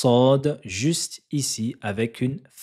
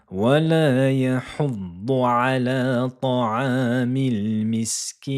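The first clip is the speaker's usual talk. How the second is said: Quran recitation; 3 semitones higher, 3 dB louder than usual. Shown as a demonstration. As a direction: recite the verse in melodic chant.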